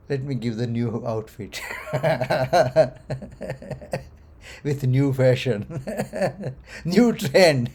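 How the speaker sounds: chuckling between the phrases; amused, laughing. Acted. Teacher voicing God handing out a new outfit, laughing. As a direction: toss out each phrase and laugh after it.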